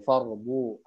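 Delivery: ordinary talk, nothing marked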